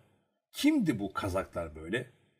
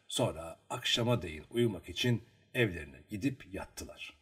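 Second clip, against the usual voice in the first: ordinary delivery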